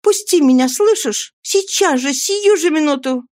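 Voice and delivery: high, urgent female voice; high-pitched, agitated woman's command; demanding Nancy. A narrator's reading.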